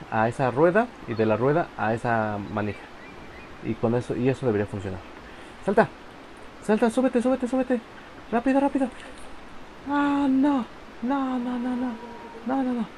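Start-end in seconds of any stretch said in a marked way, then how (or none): 5.6-12.8 high, tense pleading; frantic urging. Real, not acted. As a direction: urge it on in a panic. Urging the character across jump.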